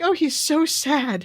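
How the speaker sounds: high, slow, hammed-up pity; mock pity. Retelling the ending, Maria pities Alucard in a high, slow coo. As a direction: coo it, sorry for him, a bit overdone. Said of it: "And she's like".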